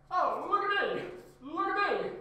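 high-pitched, loud mocking singsong; mocking imitation. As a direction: taunt them with a high mimicking voice. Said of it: "That's what you sound like".

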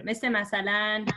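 ordinary talk

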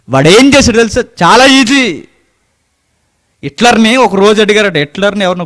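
high, loud, fast preaching; fiery preaching. Acted. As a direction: drive the point home to the congregation. Preaching at full force. (ఉద్రేకంగా బిగ్గరగా ప్రసంగం)

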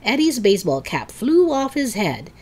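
plain reading voice

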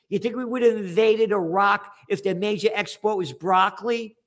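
normal talking voice

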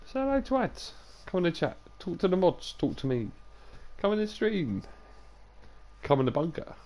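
lilting high invitation to chat; high inviting call. Real, not acted. Calling new viewers into chat.

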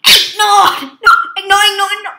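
very loud, high, panicked yells; frantic shouting. Real, not acted. Player losing at a voice-controlled game, shouting frantically. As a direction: yell it over and over in a panic.

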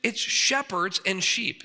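high, slow, mock-naive tone; voicing a simple churchgoer's idea; wide-eyed naive take; naive mock quote. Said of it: "the average person ... thinks".

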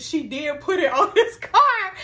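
high and loud, laughing through the words; laughing delight. Real, not acted. Delighted by the girlfriend's tracker trick, laughing out loud. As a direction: crack up at the twist.

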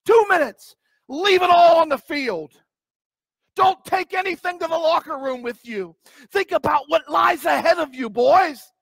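high, loud fourth-quarter pep talk; football coach. Retold or imitated. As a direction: fire up the team, coach. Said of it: "It's almost like a coach saying".

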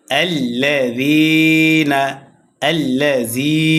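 slow recitation, lengthened "যী" in আল্লাযীনা; madd tabai. Shown as a demonstration. Teacher showing one-alif madd, stretched যী in আল্লাযীনা. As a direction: hold the "যী" of আল্লাযীনা. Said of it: "এক আলিফ টান হবে মাদ্দে".

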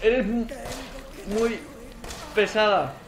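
loud, high, fed-up complaint; exasperated yelling. Real, not acted. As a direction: yell at a relentless pursuer. Yelling at relentless game enemy. (queja a gritos, desesperado)

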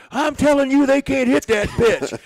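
high, loud, slow insisting voice; imitating the pitcher. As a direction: insist like a stubborn young pitcher.

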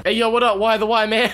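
high-pitched rapper impression. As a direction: call out a hyped rapper greeting. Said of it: "my best [name] impression".

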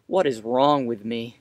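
ordinary talk, nothing marked

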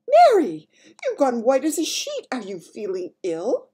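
very high, loud cry easing into concern; alarmed Queen. Acted. Queen to her pale maid, alarmed. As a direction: call out in alarm, then settle.

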